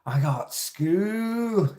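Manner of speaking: high, drawn-out SCHOOL in a mimicked accent; comic high-pitched accent impression; put-on accent. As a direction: imitate the kid's accent, stretching the last word way up. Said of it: "you do awesome with that accent".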